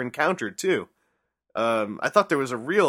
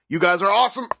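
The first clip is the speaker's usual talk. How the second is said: higher, louder burst of praise; enthusiastic letter read; 7 semitones higher, 5 dB louder, faster than usual. Acted. Reading out a listener's praise, enthusiastic. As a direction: read the cheer out big.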